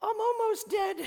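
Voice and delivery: high, comic falsetto voice; Monty Python. Acted. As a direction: squeak it out like a sketch-comedy casualty. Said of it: "Mighty Python".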